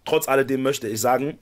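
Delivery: ordinary delivery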